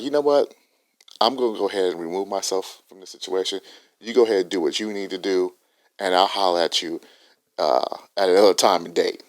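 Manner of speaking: coolly withdraw from the fight, low and soft; calm quiet quote. Retold or imitated. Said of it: "he was like".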